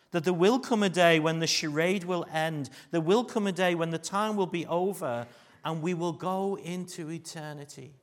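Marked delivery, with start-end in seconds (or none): none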